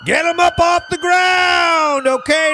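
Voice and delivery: mock rallying holler; hollered call. Mock calling out over overdose.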